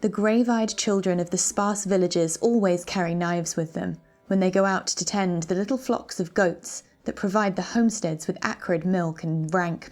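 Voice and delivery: ordinary delivery, nothing marked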